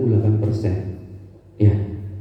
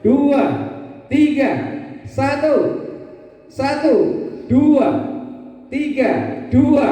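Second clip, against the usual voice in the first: calling out counts; 12 semitones higher, slower than usual. Shown as a demonstration. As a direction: drill the audience with sharp calls. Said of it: "kita lagi ulangi".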